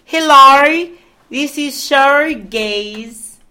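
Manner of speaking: loud, drawn-out mock Australian; Australian accent. Co-host introducing herself, mock Australian. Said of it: "gonna do mine Australian".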